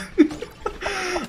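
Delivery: short squeaky high laugh. Real, not acted. Gamer laughing at buggy jetpack, squeaky laugh.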